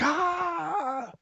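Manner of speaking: long high scream held back in volume; imitated freak-out yelp, stretched and high; startled scream. Retelling a shoulder tap that startled him, mock scream. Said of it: "freaked out".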